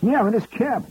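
high, brash Bronx New York voice; Fordham Road Bronx accent. Retold or imitated. Tipsy executive boasting to comic, in Bronx accent. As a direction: drop the English polish, go full Fordham Road. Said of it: "he's talking like he's from Fordham Road".

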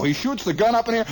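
ordinary talk, nothing marked

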